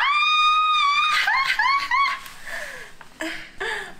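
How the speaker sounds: very high squealing laugh; shrieking laugh. Real, not acted. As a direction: shriek with joy.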